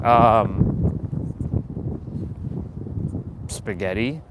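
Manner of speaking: low, hesitant, uninterested answer; bored, monotonous reply. Acted. Andre asked what he'll eat, low bored reply. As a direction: answer as if you barely care. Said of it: "they're boring, and they're monotonous".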